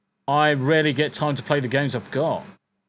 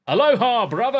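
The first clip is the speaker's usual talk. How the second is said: calling out; 9 semitones higher, 4 dB louder than usual. Real, not acted. Loud greeting call.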